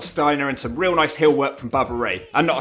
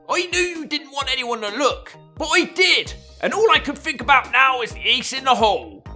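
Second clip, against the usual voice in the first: high woman voice; 10 semitones higher than usual. Pretend. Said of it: "Goldilocks remarked".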